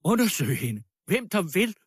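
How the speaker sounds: high, heated cry; shouted demand. A narrator's reading. Woman daring anyone to search the girl, shouting. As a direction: call out a challenge to the whole room. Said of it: "råbte fru Marmeladov".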